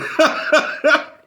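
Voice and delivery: a loud, high burst of laughter. Real, not acted. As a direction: cackle at your own joke. Laughs after teasing question.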